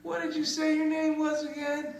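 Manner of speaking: very high, squeaky voice; mock-nervous question. Comic asking accuser her name, high mock-nervous squeak. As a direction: pitch it way up, feigning worry. Joking panicked question after awkward confession.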